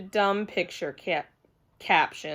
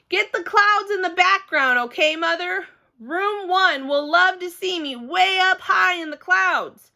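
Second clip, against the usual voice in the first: put-on bossy excited kid; 8 semitones higher than usual. Girl ordering mother how to take picture, bossy. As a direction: direct the shot eagerly.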